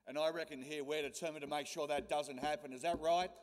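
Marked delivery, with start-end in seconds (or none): 2.7-3.3 rallying call. Real, not acted. Rallying crowd for a response.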